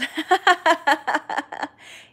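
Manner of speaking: loud high laugh bursting out; loud laugh at her own joke; big burst of laughter. Real, not acted. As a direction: break into a loud, delighted laugh. Laughing at morning sickness joke.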